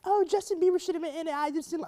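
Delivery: high-pitched mock female fan; imitating complaining women. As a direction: mimic fans' complaints in a high voice. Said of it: "a lot of women that were like".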